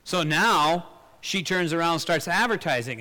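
ordinary delivery

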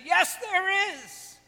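playful long call to kayakers far off; calling across. Retold or imitated. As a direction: stretch it out so it carries over the water. Answering ladies across the lake.

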